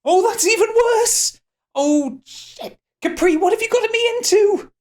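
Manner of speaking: very high, loud, stretched wail; panicked outcry. Acted. Character panics over the symbol.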